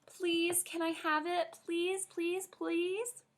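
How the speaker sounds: put-on high, pleading little-girl please; begging child. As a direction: beg like a kid who wants a toy. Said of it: "I folded my hands real begging".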